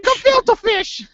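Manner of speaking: very high, drawn-out caricature mother. Retold or imitated. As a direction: stretch it out in a piercing mom impression.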